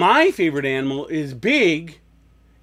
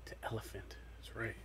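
whispering; 10 semitones lower, 30 dB quieter, faster than usual. Real, not acted. Whispered answer to child.

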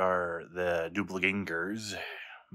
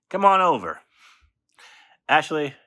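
excited call; 8 semitones higher, 11 dB louder than usual. Real, not acted. Inviting her for beef stew.

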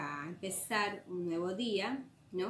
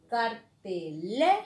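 "cartelera" syllable by syllable, LE punched; slow, loud LE inside a split "cartelera"; stress on LE; 5 dB louder, slower than usual. Shown as a demonstration. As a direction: slowly break up "cartelera", leaning hard on LE. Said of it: "donde cae la mayor fuerza de voz".

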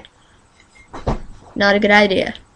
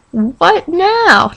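higher teasing jab; mock taunting; 7 semitones higher than usual. Girl teasing Louisville fans, in a mock taunt. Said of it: "No, I'm just kidding".

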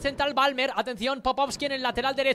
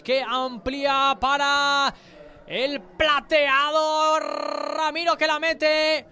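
slow, drawn-out, louder goal call; stretched goal call; 2 semitones higher, 5 dB louder, much slower than usual. Real, not acted. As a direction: build to the goal, stretching words. (narración de gol alargada)

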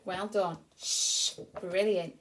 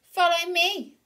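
Dizzy Duck; 8 semitones higher, 8 dB louder than usual. Acted. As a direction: invite the ducklings along. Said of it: "Dizzy leads".

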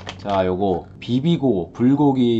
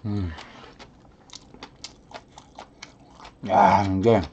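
talking while chewing; muffled through food; mouth full, chewing; 2 semitones lower, slower than usual. Real, not acted. Seen 3: cheeks bulging, lips pursed, chewing through all labelled frames.

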